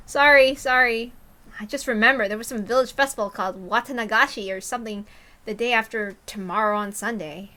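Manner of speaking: ordinary delivery, nothing marked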